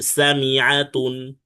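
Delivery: সামিআতুন with a clear ain before আতুন; clear ain sound. Shown as a demonstration. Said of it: "আইনটা ভালো করে উচ্চারণ করতে হবে".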